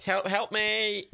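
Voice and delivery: high, drawn-out plea; calling for help. Real, not acted. Gamer calls Scouse Jimmy as mortar sets, urgent plea. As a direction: wail for rescue, stretching the words.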